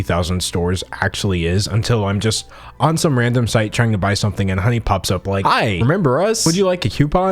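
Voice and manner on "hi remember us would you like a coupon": high, chirpy sales greeting; higher, sing-song helpful popup; perky pop-up voice. Acted. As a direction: pipe up like an eager pop-up ad.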